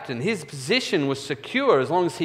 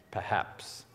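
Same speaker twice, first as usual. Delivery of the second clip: whispered perhaps; 5 semitones lower, 11 dB quieter than usual. Real, not acted. Doubting aside about forgiveness.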